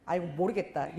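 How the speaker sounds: normal talking voice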